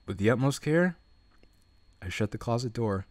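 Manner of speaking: low, soft narration read; story narration. A narrator's reading. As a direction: read the scene in a low hushed voice.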